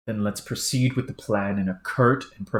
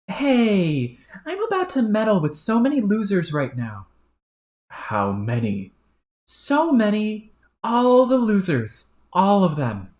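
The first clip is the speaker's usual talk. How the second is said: higher, gloating, drawn-out boasting; gleeful troll; 10 semitones higher, 4 dB louder than usual. Acted. Troll bragging to his partner, higher and gleeful. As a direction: crow about meddling with the losers. Brash character voice.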